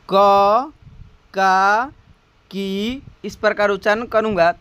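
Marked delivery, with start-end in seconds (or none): 0.1-3.0 क, का, की as separate held syllables; teacher modelling each vowel sign; slow pronunciation drill. Shown as a demonstration. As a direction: recite क, का, की one by one with pauses. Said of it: "धीरे धीरे उच्चारण भी करना है".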